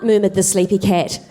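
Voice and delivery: normal talking voice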